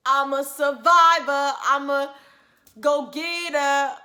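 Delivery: loud, slow, proud declaration; proud boast. Real, not acted. Telling viewers she survived, loud slow proud boast. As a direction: announce it like a champion.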